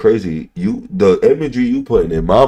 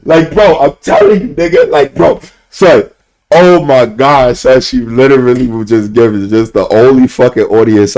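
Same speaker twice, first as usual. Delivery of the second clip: higher, louder, worked-up telling; can't contain the excitement; excited; 4 semitones higher, 6 dB louder than usual. Real, not acted. Streamer hypes up his crush story to chat, loud and excited.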